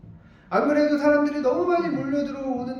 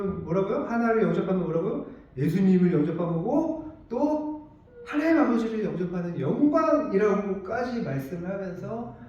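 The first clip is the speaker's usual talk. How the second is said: ordinary talk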